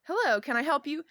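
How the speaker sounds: lightly acted shop greeter. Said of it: "the greeter".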